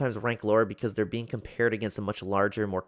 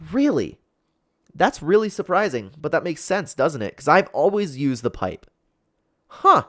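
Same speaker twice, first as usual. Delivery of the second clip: surprised; 6 semitones higher, 10 dB louder than usual. Real, not acted. Surprised by the research.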